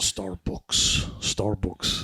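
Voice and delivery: muddled Russian-Irish mock accent; attempted Russian accent. Shown as a demonstration. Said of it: "How do you say Starbucks in a Russian accent?".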